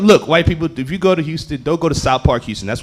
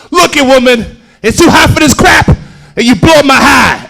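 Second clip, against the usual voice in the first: high, loud mock angry outburst; loud arguing; 8 semitones higher, 5 dB louder, slower than usual. Charlie turning on his girlfriend at gas station, loud mock arguing. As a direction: imitate a boyfriend yelling back. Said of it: "crackhead couples argue really loud".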